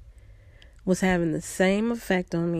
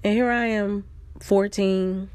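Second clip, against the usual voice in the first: ordinary talk, nothing marked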